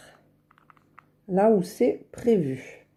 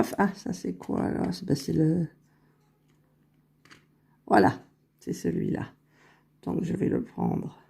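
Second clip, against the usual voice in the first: whispering under her breath while working; concentrated whisper; 5 dB quieter than usual. Real, not acted. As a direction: drop to a focused whisper, barely voiced. Concentrating on a tricky nail placement.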